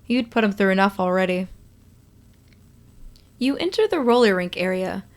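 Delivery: ordinary delivery, nothing marked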